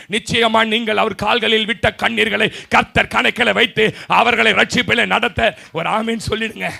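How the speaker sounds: loud, emphatic sermon promise; full-force preaching. Acted. Preacher promising God counts their tears, full-force preaching. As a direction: drive it home loudly to the congregation.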